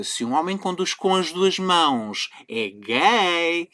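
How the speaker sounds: lightly voiced imbecile, voice swinging up; mocking imitation. Man quoting a video maker's absurd claim, mocking imitation. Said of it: "de uma criatura completamente imbecil a dizer que".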